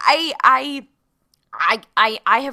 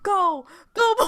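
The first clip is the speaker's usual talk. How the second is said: a higher mock-crying "go, go, boy"; mock tearful shoo; 7 semitones higher than usual. Chewie sending Han away like a dog, mock tearful.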